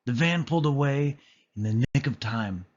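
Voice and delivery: usual speaking voice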